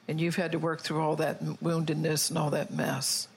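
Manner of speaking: usual speaking voice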